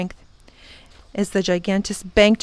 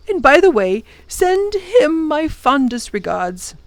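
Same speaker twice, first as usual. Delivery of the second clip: put-on higher, gentle reassuring old man; tender professor; 4 semitones higher than usual. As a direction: professor reassuring the children he'll wait, tenderly. Professor sends them off.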